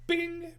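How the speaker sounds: mouth-made bright ding sound; ding like an elevator arriving; high elevator ding. Acted.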